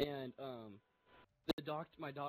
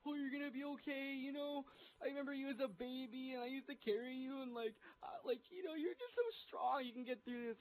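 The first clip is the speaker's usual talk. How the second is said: high, put-on motherly voice; fretful mother impression; 11 semitones higher than usual. Mother consoling her injured child in the car, high overdone tearful reassurance. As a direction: imitate mom comforting a dying child. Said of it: "talking to me like I was going to die".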